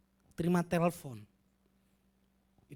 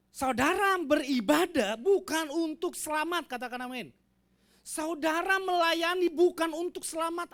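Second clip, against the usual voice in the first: preaching at full force; 8 semitones higher, 9 dB louder than usual. Acted. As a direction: build the sermon to a rousing peak. Preaching climax.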